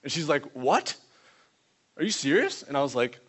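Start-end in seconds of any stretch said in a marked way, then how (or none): 0.5-2.6 imitated astonished girl, rising questions. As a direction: act out her stunned disbelief. Retells girl astonished at healing.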